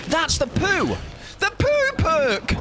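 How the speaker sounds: squealing high and slow about the perk; high excited shouting. Real, not acted. Streamer spotting the poo perk, high excited yell. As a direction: yell with delight.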